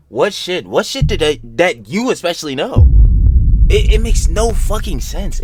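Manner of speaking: loud, high frustrated outburst; exasperated outburst. Real, not acted. Streamer ranting at the message, loud and frustrated. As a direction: demand an answer, fed up.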